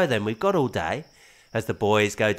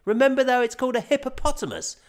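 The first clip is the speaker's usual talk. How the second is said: ordinary delivery, nothing marked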